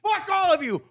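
high-pitched shouted rant; acted rage, yelling every phrase at full pitch; furious outburst. Man storming off to get in the water, furious shouting. Climax of retold rage at staff.

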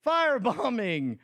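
high, showy sideshow pitchman; loud carnival barker. Retold or imitated. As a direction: hawk it like a fairground huckster. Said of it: "and I'll be like".

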